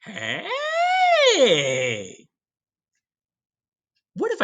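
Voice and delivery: high excited cry of discovery; sudden bright idea, called out; eureka shout. Retold or imitated. Teacher acting Columbus struck by an idea, excited shout.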